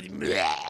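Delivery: low retching bleh; mock retch. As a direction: fake a vomit noise. Vomiting sound.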